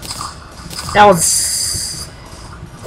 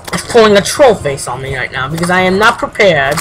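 ordinary delivery

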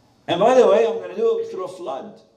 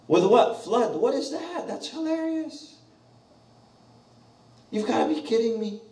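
high mock incredulity; mocking disbelief; 8 semitones higher, 5 dB louder than usual. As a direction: laugh off the warning. Scoffers mocking God's warning.

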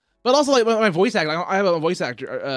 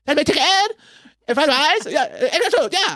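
high, lightly imitated Chinese accent; a quick Chinese accent imitation; 11 semitones higher, 4 dB louder than usual. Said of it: "I'll start morphing into it".

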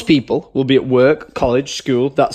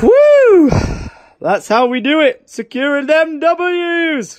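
triumphant whoop; 10 semitones higher, 3 dB louder, slower than usual. Real, not acted. Celebrating a game win.

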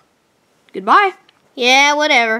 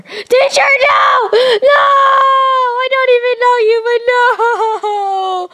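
anguished crying out; 6 semitones higher, slower than usual. Acted. Acted anguish over teacher.

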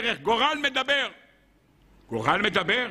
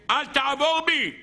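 higher, pleading mock cry; 7 semitones higher than usual. Sun cries to man against sinning, acted outcry. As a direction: call it out as an urgent warning. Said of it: "צווחת ואומרת לאדם".

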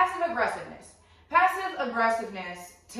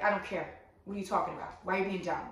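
deadpan; 2 semitones lower, 4 dB quieter, faster than usual. Acted. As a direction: shut the whiner down without emotion.

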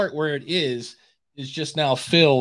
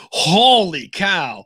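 drawn-out, higher mock astonishment; retold amazement; 4 semitones higher, much slower than usual. Recalling first hearing her speech, stretched-out astonishment. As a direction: stretch it out slowly in awe.

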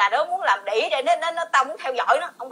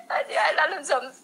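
laughing through words; 2 semitones higher, 3 dB quieter than usual. Real, not acted. Repeating husband, laughing.

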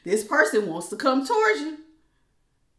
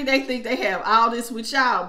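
ordinary talk

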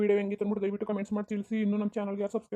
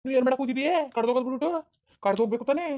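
raised-pitch, indignant questions; angry scolding; 5 semitones higher, 4 dB louder than usual. Acted. Husband ordering his wife to get out, angry.

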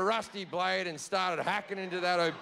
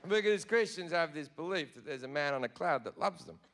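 ordinary talk

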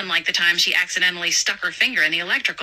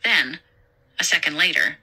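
plain reading voice

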